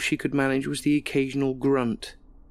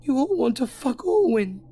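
shrill scream, much higher and louder; 13 semitones higher, 3 dB louder than usual. Acted. Alison screaming at party guests from the couch, high scream. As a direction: scream it at the whole room like a madwoman. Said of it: "started screaming".

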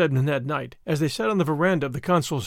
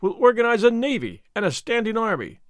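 raised, louder, eager cry; free enthusiasm; 6 semitones higher, 4 dB louder than usual. A narrator's reading. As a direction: announce it full of excitement. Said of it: "he cried, in free enthusiasm".

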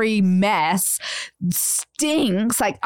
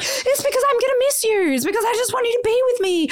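high, fast pleading husband voice; hammed-up clingy partner begging her not to leave; imitating her husband pleading to keep her home, high and fast; mock whiny husband; 12 semitones higher, much faster than usual. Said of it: "He's like".

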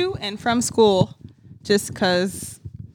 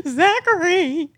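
excited; 7 semitones higher, 3 dB louder, much slower than usual. Retold or imitated. Surprised seeing brother at airport.

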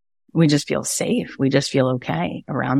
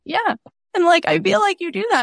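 put-on tearful child; 12 semitones higher than usual. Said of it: "she started to cry".

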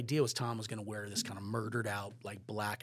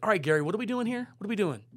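higher, louder, fast demand; brisk Tom; 10 semitones higher, 8 dB louder, faster than usual. Retold or imitated. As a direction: push Gary for answers as Tom.

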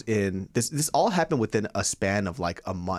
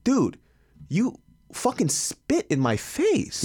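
high, drawn-out disbelief; indignant protest; 10 semitones higher, much slower than usual. Retold or imitated. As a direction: stretch it out high, like an outraged complaint. Indignant retort to spitting driver.

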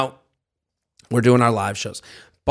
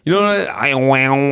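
ordinary talk, nothing marked

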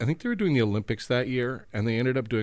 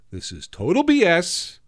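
indignant complaint; 8 semitones higher, 5 dB louder, slower than usual. Retold or imitated. Comedians complaining about unfair contest.

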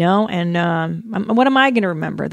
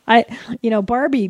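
ordinary delivery

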